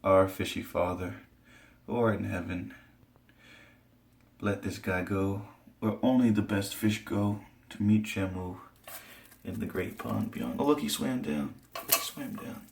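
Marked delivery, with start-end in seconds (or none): 0.0-10.6 mock prayer. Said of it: "Let's say something together". 11.9-12.6 hushed unvoiced echo; a quiet whispered repeat to himself. Real, not acted. Echoes his own words.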